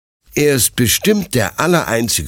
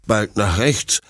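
plain reading voice

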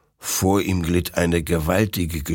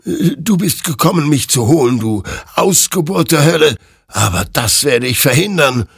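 higher and louder, desperate threat; threatening; 10 semitones higher, 6 dB louder than usual. Acted. Hektor threatens the hellish creature, gun shaking. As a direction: threaten the demon with rising fury. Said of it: "drohte Hektor".

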